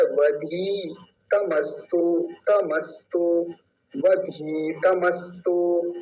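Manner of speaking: वधी with a long ई, तमस्तु slow; long i demo. Shown as a demonstration. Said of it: "बड़ी की मात्रा है". (दीर्घ ई, धीरे)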